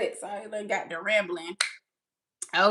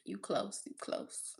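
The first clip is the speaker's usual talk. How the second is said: very soft, fading to a whisper; quiet murmur; 13 semitones lower, 15 dB quieter, slower than usual. Real, not acted. Host answering a viewer's salary guess, murmured. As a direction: murmur it under your breath. Murmurs while reading search result.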